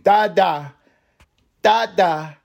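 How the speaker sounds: put-on high-pitched toddler calling for daddy; imitating baby daughter. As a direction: mimic a little girl's two-beat cry for her father. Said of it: "That's how she says it".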